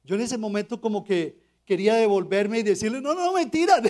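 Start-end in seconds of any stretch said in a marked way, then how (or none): 3.0-3.8 panicked takeback. Retold or imitated. Panicky takeback after walking out.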